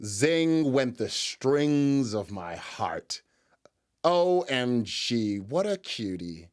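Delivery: slow, drawn-out fawning; hammed-up gushing, stretched very slow; mock swooning. Host gushing over the man in the clip, mock swoon.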